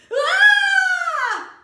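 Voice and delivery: loud, high scared "Ah!"; scared scream. Shown as a demonstration. Storyteller practising the scared noise with listeners, scared scream. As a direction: let out a long high shriek of fright. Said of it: "do your very own scared noise".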